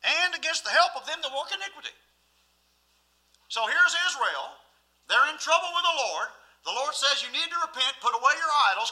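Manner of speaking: high, ringing pulpit delivery; forceful preaching. Real, not acted. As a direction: drive the warning home to the congregation.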